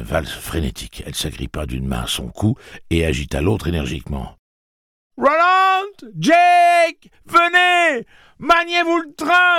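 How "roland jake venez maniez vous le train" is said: high, loud elated calls; joyful shouting. Acted. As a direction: hurry them up with a happy yell. Calling friends up from the pit.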